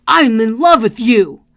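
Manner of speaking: loud, slow, hostile "I'm in love with you"; aggressive love declaration. Shown as a demonstration. Coach showing tone clashing with words, loud and hostile. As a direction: say sweet words as if ready to fight. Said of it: "you look like you're ready to fight".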